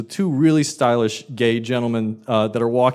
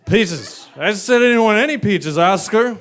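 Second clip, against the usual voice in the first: put-on angry drunk woman; 8 semitones higher, 4 dB louder than usual. Drunk customer yells at waiter.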